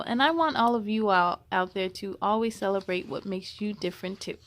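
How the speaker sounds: usual speaking voice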